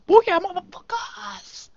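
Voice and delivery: high, drawn-out victory shout; triumphant whoop. Real, not acted. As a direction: crow over the win. Celebrating catching all three thieves.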